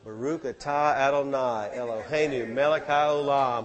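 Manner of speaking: slow, careful Hebrew blessing, Baruch atah Adonai. Shown as a demonstration. Preacher showing the church the blessing he prays, slow Hebrew. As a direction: intone it slowly in Hebrew. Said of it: "What am I doing?".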